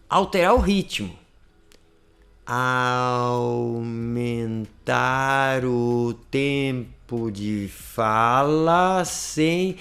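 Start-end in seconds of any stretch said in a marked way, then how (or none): 2.4-9.8 stretched out very slowly, sounds held long; one long, continuous slow stretch of speech; slow motion. Shown as a demonstration. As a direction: drag each sound out like slow-motion film. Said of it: "como se botasse em câmera lenta".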